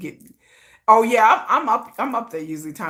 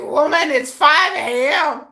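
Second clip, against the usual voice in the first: put-on groggy boyfriend, slow; 8 semitones higher, 5 dB louder, slower than usual. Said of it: "He's like".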